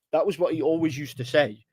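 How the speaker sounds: normal talking voice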